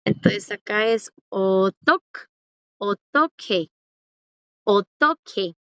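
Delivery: slow "otok, otoque" with a clear hard k; ideal pronunciation. Shown as a demonstration. Teacher showing students the ideal pronunciation, slow and careful. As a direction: model the ideal "otoque" slowly. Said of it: "La pronunciación ideal".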